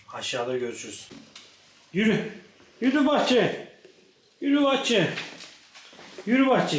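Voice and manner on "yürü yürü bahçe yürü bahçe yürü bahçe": high, louder singsong call; calling the dog. Real, not acted.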